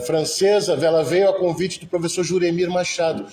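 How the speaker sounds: usual speaking voice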